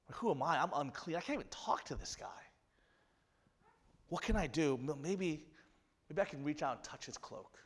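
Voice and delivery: hushed, breathy desperate self-talk; whispered inner plea. Acted. An unclean woman doubting herself, hushed. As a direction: murmur it like a secret hope.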